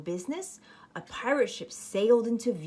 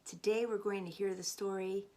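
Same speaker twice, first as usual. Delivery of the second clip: ordinary delivery, nothing marked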